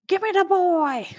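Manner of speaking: shouted imitation of a menacing doll; demanding yell. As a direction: holler it like an attacking killer doll. Acting out Chucky attacking through door.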